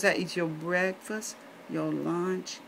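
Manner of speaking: put-on soft German-accented nurse; hushed German accent, falling away at the end. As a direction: list it quietly in a mock German accent. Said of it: "Helga has a really heavy German accent".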